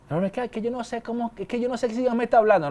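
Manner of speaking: soft, hurried mock excuse; small, quick protest; doubting believer. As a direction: voice a flustered objection under your breath.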